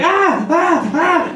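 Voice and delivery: high, tiny mock squeaks; a squirrel screaming if it could, high mock squeaks; squirrel's tiny scream. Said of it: "if it could be screaming".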